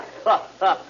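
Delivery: [name] laughing as he greets his fake Australian friend; hearty laugh. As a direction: burst out laughing at the old pal.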